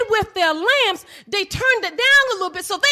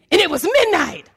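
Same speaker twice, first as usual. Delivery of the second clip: ordinary talk, nothing marked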